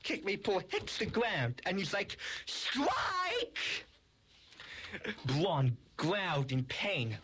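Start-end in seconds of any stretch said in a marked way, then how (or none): 2.5-3.8 high, drawn-out victory call; triumphant cry. Acted. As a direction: crow it like a bowler's winning shot. Thrown toy cries out on hit.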